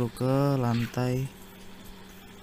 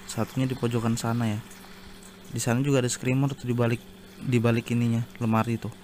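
usual speaking voice

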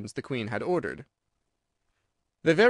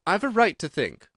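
sharp retort; 6 semitones higher than usual. A narrator's reading. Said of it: "said Alice sharply".

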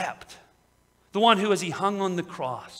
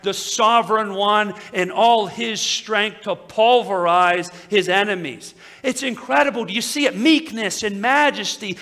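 louder and higher, building to a triumphant peak; full-force preaching; 4 semitones higher, 9 dB louder than usual. Real, not acted. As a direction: proclaim it like a thundering revival preacher.

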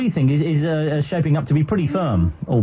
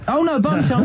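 mock disbelief; 10 semitones higher than usual. Real, not acted. Incredulous reaction to silly name.

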